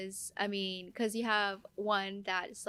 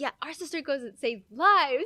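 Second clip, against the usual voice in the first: louder, higher proud brag; proud sisters boasting; 6 semitones higher, 8 dB louder than usual. Retold or imitated. Sisters bragging to others about her, loud and proud. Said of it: "they'll be like".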